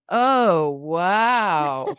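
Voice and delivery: loud, drawn-out appreciative wow; big swooping oh wow; cooks' admiring wow. Retold or imitated. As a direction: mimic an ogling, impressed cook. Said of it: "the cooks go".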